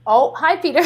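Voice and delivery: loud call to another room; yelling across the room. Real, not acted. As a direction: call out to him from upstairs. Calling to husband in another room.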